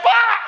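a high mock shriek. Imitating the wife shrieking at the husband, high mock cry. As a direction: let out a high startled cry.

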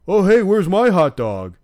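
loud, high, goofy voice mocking a loser; mocking dorky guy. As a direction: ham up the clueless guy calling out to the girls. Mocking the dorky guy's line.

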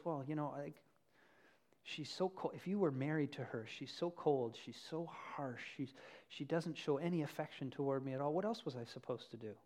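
soft, mumbling excuse-making; soft, sulky husband; self-pitying excuse. Retold or imitated. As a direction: whine quietly that it's her fault. Cheating man justifies himself.

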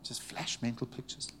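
hushed whisper. Real, not acted. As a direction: breathe it out as a quiet whisper. Hushed aside after moving story.

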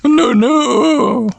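high creature wail; Numemon voice. Acted. Numemon cries out and runs away, creature voice. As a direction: cry out high as a little monster.

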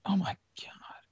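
exasperated breathy whisper; real annoyed whisper at the screen; whispered oh my god. Real, not acted. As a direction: mutter it under your breath in exasperation. Annoyed by autoplay videos.

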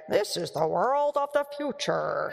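slow, drawn-out ride announcer voice; People Mover announcer. Retold or imitated. Author imitating the People Mover ride for the audience, announcer voice. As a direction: proclaim it slowly, like a theme-park ride narration. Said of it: "it would show you".

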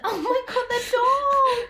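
high squeal over a cute dog. Real, not acted. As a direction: squeal with delight. Reacting to dog video.

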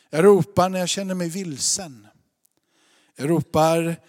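jag ropar called out loud; a preacher's loud call; loud cry. Acted. Preacher crying out examples.

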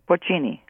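porcini settled on a CH, "por-CHEE-nee"; ch pronunciation. Shown as a demonstration. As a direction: land on ch for the c in porcini. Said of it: "I never can pronounce Italian".

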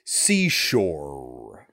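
low "Seashore" with a long, heavy final R; overdone hard R. Shown as a demonstration. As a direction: drag out the R ending "Seashore". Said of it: "he talks like shore".

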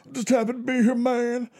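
high, dazed mumbling wrestler voice; Bray Wyatt impression. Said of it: "Where he's all".

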